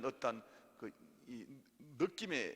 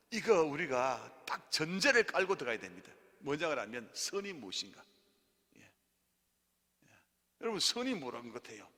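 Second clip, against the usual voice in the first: ordinary talk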